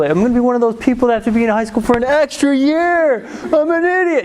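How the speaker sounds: high, escalating whine; hammed-up despairing rant, climbing in pitch; mock really mad. Mad kid rants to the counselor about falling behind, high whine. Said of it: "really mad at this point".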